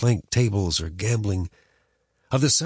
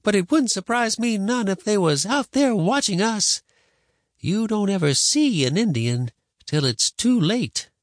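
high-pitched grandma, drawing out the warning; ominous grandma; 12 semitones higher, 3 dB louder than usual. Acted. Grandma warning boy they may be watched, ominous. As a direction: warn darkly in a raised old-woman voice.

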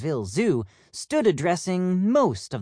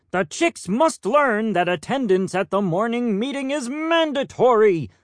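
stern peacock; 7 semitones higher, 4 dB louder than usual. Acted. Head peacock scolds Plum.

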